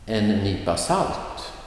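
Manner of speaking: salt lifted above En nypa; stress on salt. Shown as a demonstration. Teacher models an example phrase, stress on salt. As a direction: lean on the last word, salt. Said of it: "the noun will get the emphasis".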